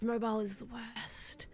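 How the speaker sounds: whispered, unvoiced; whispered aside. Real, not acted. Streamer whispering his complaint about the app, conspiratorial. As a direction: lean in and whisper it.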